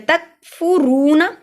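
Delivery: slow तकफुरूना with long फु and रू; careful recitation. Shown as a demonstration. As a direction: close तक cleanly, then stretch फु and रू. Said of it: "तक पढ़ना है".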